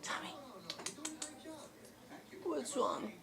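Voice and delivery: ordinary talk, nothing marked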